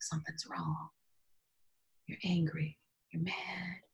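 very soft, almost whispered realization; hushed self-talk. Retold or imitated. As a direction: retell a private reckoning under your breath. Retelling hushed talk to herself.

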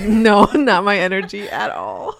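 loud, high laughing denial; emphatic no through laughter; laughing protest. Real, not acted. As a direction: laugh it off loudly, drawing out the words. Laughing rejection of the imagined judgement.

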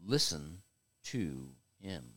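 a hushed divine command from above; voice of God. Retold or imitated. God speaks to Peter from the cloud, low and hushed. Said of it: "And then he says".

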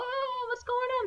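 very high, thin imitated wail; mock alarmed passengers. Cruise passengers as a big wave rises, mock alarm.

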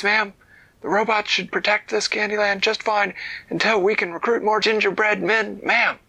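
high, fast little soldier voice; high gingerbread man. Acted. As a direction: chirp it quickly like a tiny soldier.

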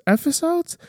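very high rising episodes; playful high-pitched question; mock surprised question. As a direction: squeak up on the last syllable. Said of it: "What is going on?".

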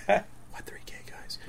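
slow, whispered question; feigned innocence. Retold or imitated. Vendor answering the friend's reproach, whispered innocence. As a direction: whisper it as if clueless.

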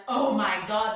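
loud, gushing mock outburst; mock freak-out. Coach imitating the reaction she discourages, mock freak-out. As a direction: burst out like someone overwhelmed by good news.

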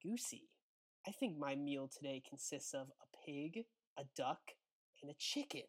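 soft, menacing near-murmur; sadistically low voice. Acted. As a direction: drop it down, savour the threat. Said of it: "in a sadistically low voice".

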